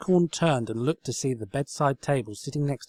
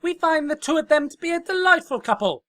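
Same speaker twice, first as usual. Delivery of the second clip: high, loud royal we; loud high regal decree; regal Sofa; 8 dB louder than usual. Acted. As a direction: pronounce it like a stately princess.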